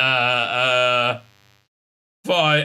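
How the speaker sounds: usual speaking voice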